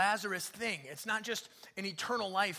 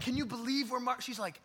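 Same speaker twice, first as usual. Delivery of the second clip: usual speaking voice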